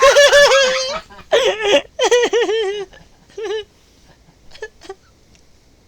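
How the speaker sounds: loud high laugh close to crying; shrieking with laughter; laughing till tears; howling laughter. Real, not acted. Cracking up at the story.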